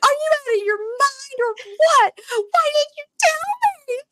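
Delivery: high, loud, slow imitated yell; mock yelling. Wife yelling at husband for hiding news, hammed-up yell. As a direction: scream it at him, slow and outraged. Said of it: "she's yelling at him".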